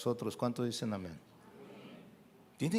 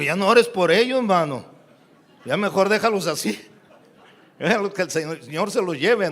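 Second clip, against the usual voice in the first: louder, laughing through the advice; laughing joke; 4 semitones higher, 10 dB louder than usual. Retold or imitated. Preacher retelling his joke to a brother, laughing loudly. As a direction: tease him while laughing.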